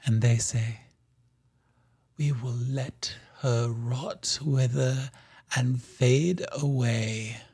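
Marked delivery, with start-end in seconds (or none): 2.2-7.4 soft, slow, cold verdict; sibling quietly wishing her gone. Acted. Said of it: "their voice".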